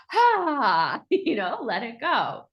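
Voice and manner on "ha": sharp, high, loud "Ha" letting the stress out; releasing "Ha". Shown as a demonstration. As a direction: bark out one freeing "Ha". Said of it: "let out a".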